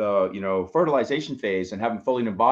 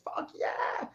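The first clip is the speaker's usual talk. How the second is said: hushed cheer; 10 dB quieter than usual. Real, not acted. Cheers after affirmation is repeated.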